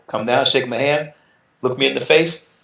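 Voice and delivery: ordinary talk, nothing marked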